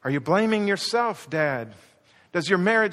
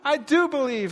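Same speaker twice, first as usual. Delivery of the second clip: high-pitched, stammered cry of belief; 7 semitones higher, 4 dB louder than usual. Acted. Father answering Jesus about his faith, crying out. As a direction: cry out in desperate insistence. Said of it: "the boy just cries out immediately again".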